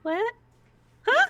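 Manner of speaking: put-on a four-year-old caught off guard; lightly voiced startled little girl; Missy the little girl.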